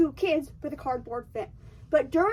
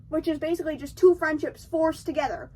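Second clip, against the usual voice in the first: normal talking voice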